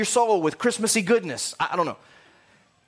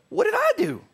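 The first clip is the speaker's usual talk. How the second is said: much higher, whiny protest; innocent Satan; 11 semitones higher than usual. Acted. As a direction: play the wronged devil squeaking innocence.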